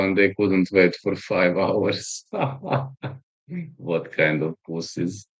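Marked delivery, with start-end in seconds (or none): 2.3-3.1 short high chuckle; quick real laugh, softer than the talk. Real, not acted. Amused at trolls who couldn't wait, laughing.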